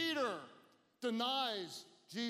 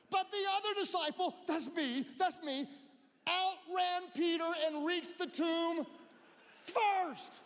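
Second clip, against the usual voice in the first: loud, high preaching, pushed to a peak; preaching at full force; 8 semitones higher, 4 dB louder than usual. Acted. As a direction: build it up to the final word. Preacher acting John's boast.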